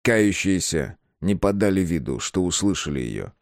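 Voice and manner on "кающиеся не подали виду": plain reading voice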